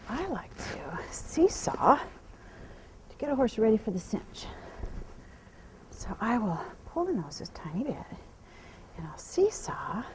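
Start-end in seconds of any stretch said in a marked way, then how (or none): 5.9-10.0 hushed explaining whisper; quiet whisper. Real, not acted. Trainer explaining the seesaw while on her mare, whispered. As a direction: whisper the steps so the horse stays calm.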